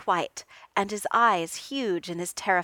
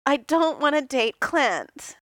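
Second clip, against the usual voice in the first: sulky repeat; 6 semitones higher, 5 dB louder, slower than usual. A narrator's reading. Tony repeats sulking.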